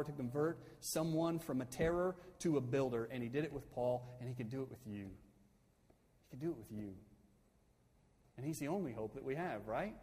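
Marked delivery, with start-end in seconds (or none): none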